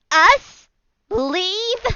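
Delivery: high, loud disbelief; incredulous retort. Acted. Angry figures told to leave, incredulous retort. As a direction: throw the words back, incredulous. Acted mocking retort after laughing.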